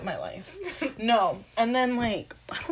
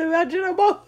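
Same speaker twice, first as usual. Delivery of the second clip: high, laughing fake sobs; mock crying; 10 semitones higher, faster than usual. Friend imagining crying when they dislike sex, high mock crying. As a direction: wail it, half laughing. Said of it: "I start crying".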